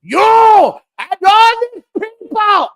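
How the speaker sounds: full-throated, high-pitched scream imitating a wrestler; over-the-top mock wrestling promo yell. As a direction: scream it as loud as you can into the mic. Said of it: "screaming as loud as he can into the microphone".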